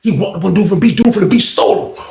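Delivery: ordinary talk, nothing marked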